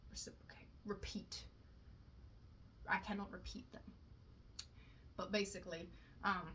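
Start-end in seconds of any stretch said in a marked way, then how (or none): 0.1-1.4 a quick whispered word check; whisper to self. Real, not acted. Checking a word under her breath while explaining.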